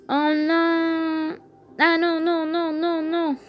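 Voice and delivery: high, rising panic cries; real dismay at losing; panicking gamer. Real, not acted. Losing the game suddenly.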